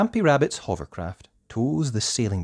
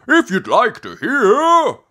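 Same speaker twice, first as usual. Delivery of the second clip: high, loud, eager offer; Grampy Rabbit; 11 dB louder than usual. Acted. Grampy Rabbit tempts visitors with tales, loud eager voice. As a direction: dangle the offer loudly. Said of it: "says Grampy Rabbit".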